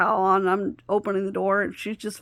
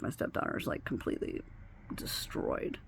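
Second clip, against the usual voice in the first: breathy near-whisper, much softer; 8 semitones lower, 12 dB quieter than usual. Real, not acted. Telling a friend how crushed her stepdaughter was, whispered. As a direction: let the words sink into a whisper.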